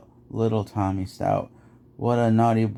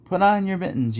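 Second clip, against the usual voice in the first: higher-pitched mother cat; 9 semitones higher than usual. Acted. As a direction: lift the voice lightly, as mother to kittens. Mother cat addresses her kittens.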